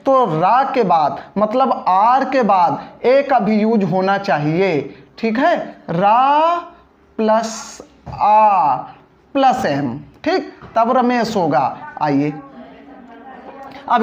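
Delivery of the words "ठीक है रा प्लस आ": रा and आ drawn out slowly, long open आ; stretched aa sound. Shown as a demonstration. Teacher showing students the आ sound, slow and stretched. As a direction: lengthen the आ in रा to show the vowel. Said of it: "जब हम रा बोलते हैं तो आ की ध्वनि आती है".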